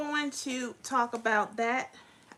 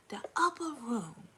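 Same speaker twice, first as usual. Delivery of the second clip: whisper; 2 semitones higher than usual. Real, not acted. Hushed aside about senior citizens.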